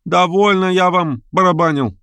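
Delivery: high, drawn-out protest; crying out. A narrator's reading. Guest refusing the piano, crying out high. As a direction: complain in a high voice, dragging the words. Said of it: "крикнул Лямшин".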